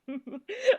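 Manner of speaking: sudden high laugh that almost cracks into a cry; high burst of laughter. Real, not acted. Vlogger bursting out laughing at a grim twist. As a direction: laugh out high and loud, can't hold it in. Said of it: "मुझे हंसी आ रही है ना".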